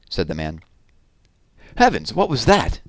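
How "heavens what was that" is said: startled. Acted. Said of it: "An eerie, long-drawn shriek".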